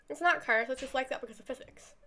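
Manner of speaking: normal talking voice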